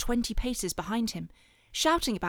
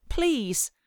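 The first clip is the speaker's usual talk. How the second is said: pleading; 6 semitones higher, 3 dB louder than usual. A narrator's reading. Boy fleeing bullies pleads with mother.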